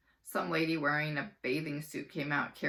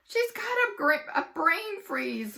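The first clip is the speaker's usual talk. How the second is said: groaning; 12 semitones higher, 7 dB louder, slower than usual. Acted. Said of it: "groaned Ryan".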